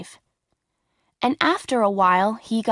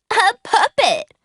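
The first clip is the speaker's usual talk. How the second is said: high, loud mocking yell; jeering shout; 11 semitones higher, 5 dB louder than usual. A narrator's reading. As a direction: taunt the puppet loudly. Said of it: "shouted the school bullies".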